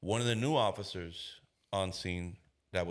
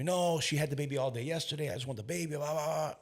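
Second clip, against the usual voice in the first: higher-pitched protest of an aggrieved dad; imitated complaint; 8 semitones higher than usual. Father complaining to the officer about the baby, imitated complaint. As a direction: rattle off a grievance in a raised pitch.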